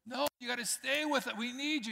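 high, startled protest; a jump up in pitch, then plead; shocked disciples. Retold or imitated. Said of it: "I'm sure they were like".

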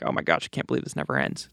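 hushed pretend exasperation; mock weary complaint. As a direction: grumble it under your breath as a joke. Mock bored complaint about eternity.

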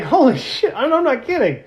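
high, loud storyteller's disbelief; shocked exclamation. Retold or imitated. Telling of the blow out of the blue, loud shocked exclamation. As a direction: blurt it out, stunned.

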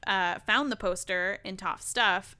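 ordinary delivery

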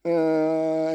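loud, level "uhhh" imitating endless rain; long monotone imitation of drizzle; droning drizzle noise. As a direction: drone on like annoying drizzle. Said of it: "It's just like".

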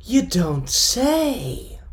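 high, drawn-out mock-surprised exclamation; slow, swooping, high sarcastic exclamation; meme voice. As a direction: put on an exaggerated internet meme voice. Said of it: "that was my best meme voice".

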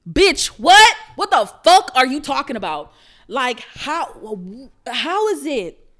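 stunned shout at the friend's remark; shocked shout. Real, not acted. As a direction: blurt it out in disbelief.

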